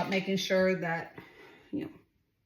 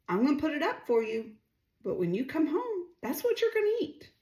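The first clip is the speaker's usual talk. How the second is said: firm mother; 7 semitones higher than usual. Retold or imitated. Mother laying down the law.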